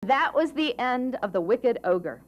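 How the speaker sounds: ordinary delivery, nothing marked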